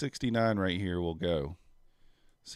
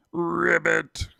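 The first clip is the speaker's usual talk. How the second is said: high, loud croaky ribbit; frog croak; 8 semitones higher, 7 dB louder than usual. Pretend.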